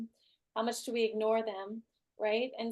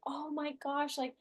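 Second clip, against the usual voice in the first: higher, slow and adoring; adoring boyfriend; 4 semitones higher than usual. Retold or imitated. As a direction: gush over her tears. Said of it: "he was like".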